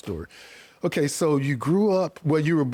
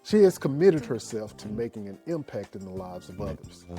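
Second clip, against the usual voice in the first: podcast intro read; 3 semitones lower, 5 dB quieter than usual. Acted. Host reads podcast intro over music.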